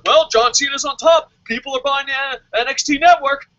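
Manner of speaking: lightly voiced smug executive, slowing to a dumb dun dun dun; mocking WWE. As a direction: parody the company's smug logic. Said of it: "W W E is like".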